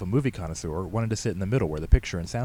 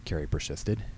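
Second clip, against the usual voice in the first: ordinary delivery, nothing marked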